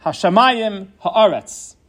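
"Hashamayim Haaretz" slowly, each stressed on its second-last syllable. Shown as a demonstration. Teacher giving examples of earlier emphasis, second-last-syllable stress on "Hashamayim Haaretz". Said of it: "the second last syllable".

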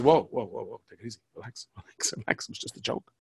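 soft, hushed calming down; whispered "relax, it was just a joke". Retold or imitated. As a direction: settle him down in a hush. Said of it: "I was like".

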